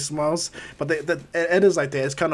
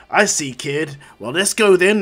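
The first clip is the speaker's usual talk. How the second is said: loud, rising battle cry; fired up for the fight; eager challenge; 3 semitones higher, 8 dB louder, slower than usual. Retold or imitated. All Might accepts the fight.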